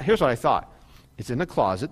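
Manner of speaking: ordinary delivery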